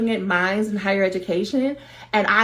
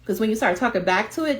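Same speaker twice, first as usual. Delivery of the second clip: normal talking voice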